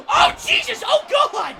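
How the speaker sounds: very high, loud screaming gasp; shocked scream. Real, not acted. Commentator to viewers, screaming in shock. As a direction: scream in disbelief.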